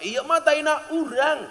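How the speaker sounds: high, slow casual reply; Sundanese villager. Retold or imitated. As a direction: answer like a calm Sundanese local. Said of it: "Dijawab sama orang Sunda".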